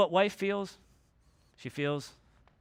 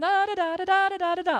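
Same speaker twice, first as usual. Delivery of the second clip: mock gushing babble; 13 semitones higher, 4 dB louder than usual. Wife gushing on and on.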